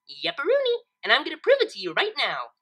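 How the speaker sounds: high, bubbly eager pony; excited pony eager to prove her math; eager Pinkie. A narrator's reading. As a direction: pop the first word way up.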